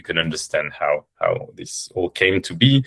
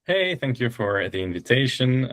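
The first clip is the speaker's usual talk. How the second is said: ordinary delivery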